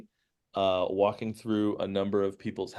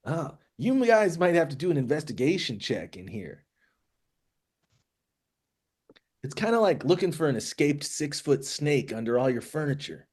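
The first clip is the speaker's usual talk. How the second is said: lightly voiced character, pitched higher; Ragnu; 5 semitones higher, faster than usual. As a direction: advise in character with swooping pitch. GM speaks as Ragnu.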